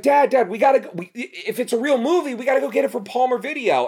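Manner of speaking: put-on high, eager kid pleading with Dad; excited kid begging. A kid begging his dad to rent Nosferatu, high and eager. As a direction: beg like a ten-year-old who can't wait.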